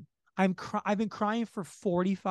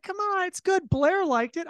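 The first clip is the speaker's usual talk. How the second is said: higher, pushy coaxing dad voice; 12 semitones higher, 4 dB louder than usual. Retold or imitated. Dad urging his daughter to eat the oyster, coaxing and higher. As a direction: egg her on like a proud father.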